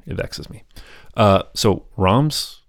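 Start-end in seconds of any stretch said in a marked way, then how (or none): 0.1-0.6 whispered. Real, not acted. Muttered aside, frustration.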